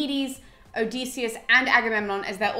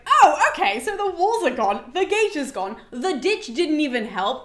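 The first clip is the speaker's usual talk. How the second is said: panicked Agamemnon; 5 semitones higher, 4 dB louder than usual. Retold or imitated. Agamemnon panicking over lost walls.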